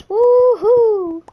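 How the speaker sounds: high, loud whoop of joy; excited cheer. Real, not acted. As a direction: cheer it out big. Cheering as the game starts.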